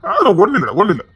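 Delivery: ordinary delivery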